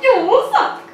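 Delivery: high mock-Italian jeer; Mario accent. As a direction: taunt in a squeaky cartoon Mario accent. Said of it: "talking in your Mario accent".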